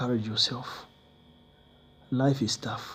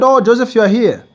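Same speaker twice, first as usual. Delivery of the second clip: much higher, louder burst of greeting; excited greeting; 9 semitones higher, 10 dB louder than usual. Retold or imitated. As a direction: greet him with a loud lift. Friend greets him at home.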